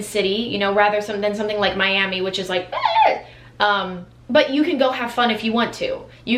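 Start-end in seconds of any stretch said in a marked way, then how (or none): 2.7-3.2 very high, short squeal; quick high-pitched "eh" noise; imitated party squeal. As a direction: mimic a wild city with a high squeal. Imitates Miami's wild energy.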